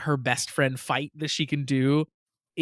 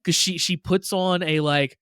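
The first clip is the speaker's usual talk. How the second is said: normal talking voice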